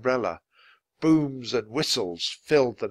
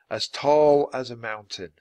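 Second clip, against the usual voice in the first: usual speaking voice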